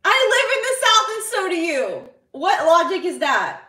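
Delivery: high-pitched, loud protest; indignant shout. Real, not acted. Streamer disputes mother's logic about the South, indignant shout. Arguing back with their mother.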